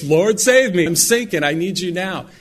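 loud, high plea of a drowning man; urgent cry for help. Acted. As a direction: cry out as if going under. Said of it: "Not, "Blessed art thou ... It was".

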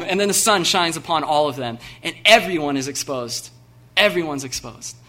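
loud, fired-up preaching that builds to a peak; preaching at full force. Acted. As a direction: preach it with everything you have, to the back row.

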